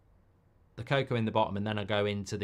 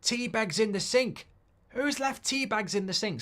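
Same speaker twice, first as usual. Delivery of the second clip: imitated shout; 10 semitones higher than usual. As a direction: call out like an angry coworker. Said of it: "you'd hear him shouting".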